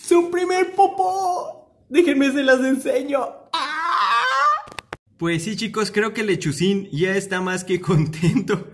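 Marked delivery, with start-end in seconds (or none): 0.0-3.2 high-pitched squeal through laughter; high, overwhelmed excitement. Real, not acted. Squealing over bird's first poop. 3.5-4.2 drawn-out high-pitched squeal; long excited shriek. Real, not acted. Squeal over the poop.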